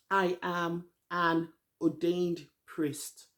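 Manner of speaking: very slow, measured announcement; solemn declaration. Retold or imitated. Host quoting Lisa's confession to the group, solemn declaration. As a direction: spell it out word by word, weightily.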